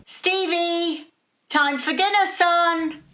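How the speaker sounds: father calling his son down to dinner; calling up the stairs. A narrator's reading. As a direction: call him down to dinner from below. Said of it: "His father's voice cut around the stairwell".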